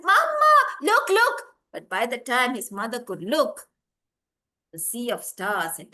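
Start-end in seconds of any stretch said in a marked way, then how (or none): 0.0-1.4 put-on high, loud child's shout; excited calling out. Boy shows his mother the stars, excited high shout. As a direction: call eagerly across a distance.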